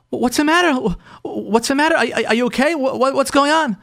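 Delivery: acted-out anxious checking on her; nervous concern. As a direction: imitate a worried husband fumbling his questions. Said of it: "He's starting to get nervous".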